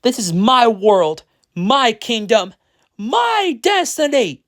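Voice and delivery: high, drawn-out villain yell. A narrator's reading. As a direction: build to a high, stretched cry on the last words. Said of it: "he yelled".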